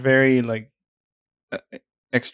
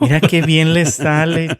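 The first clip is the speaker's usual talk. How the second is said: higher, louder gushing aunt; mock aunt praise; 6 semitones higher, 6 dB louder than usual. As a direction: marvel at him like an aunt. Mocking the aunts' praise.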